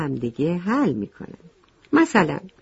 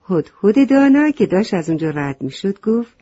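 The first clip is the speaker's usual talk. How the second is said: ordinary delivery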